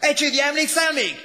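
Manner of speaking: high, full-voiced yell; urgent shouting. A narrator's reading. Man rousing his sleeping classmate, urgent high shout. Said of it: "kiabálta".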